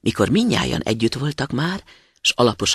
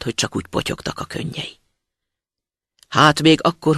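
plain reading voice

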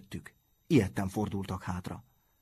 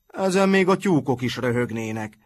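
louder scornful outburst; shouted; 3 semitones higher, 6 dB louder than usual. A narrator's reading. Matthias scoffs at the enemy's terms, shouting. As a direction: toss the scorn out loud. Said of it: "kiáltott Matthias".

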